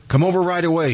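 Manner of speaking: imitating her urgent summons; urgent plea quoted. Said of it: "she said".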